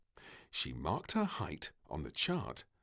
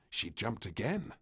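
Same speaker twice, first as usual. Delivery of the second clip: ordinary delivery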